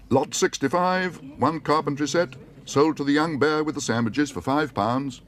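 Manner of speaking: high, slow, ringing sale-room announcement; auctioneer calling lots. Acted. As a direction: announce the lot to the whole hall.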